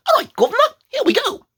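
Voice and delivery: very high, loud cartoon British; squeaky-high fake British accent; mock Cockney accent. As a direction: chirp it in overdone Cockney. Trying a cockney greeting.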